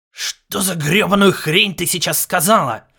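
loud, angry outburst; irritated. A narrator's reading. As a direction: snap it out in disbelief. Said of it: "раздражённо выдохнул Ларс".

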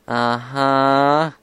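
loud, doubting uh-huh; skeptical. A narrator's reading. Big sister doubting the filly's excuse, skeptical. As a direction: draw it out in disbelief. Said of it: "Applejack asked skeptically".

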